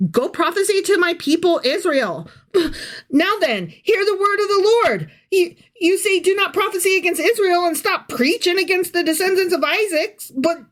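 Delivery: high, lofty proclamation with weary sighs; high, sighing pronouncement; God's voice. Acted. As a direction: declaim like a fed-up deity. Voicing God in scripture.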